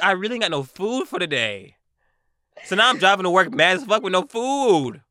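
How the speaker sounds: loud, high-pitched indignant complaint; retold mad. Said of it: "And I was mad".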